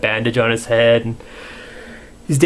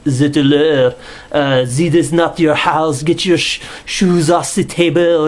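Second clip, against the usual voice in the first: mock French accent; 2 semitones higher, slower than usual. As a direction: imitate a butler's bad French accent. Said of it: "he's doing this fucking French accent".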